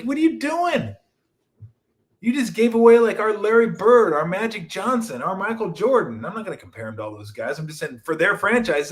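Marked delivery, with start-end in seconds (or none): 0.0-6.2 high, outraged disbelief as the betrayed fan; angry Mavericks fan. Retold or imitated. Imitating a Dallas fan yelling at the front office, high and outraged. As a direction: squeal it like a fan who just lost his star. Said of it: "they realize, like".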